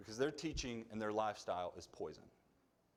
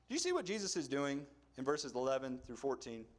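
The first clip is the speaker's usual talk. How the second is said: normal talking voice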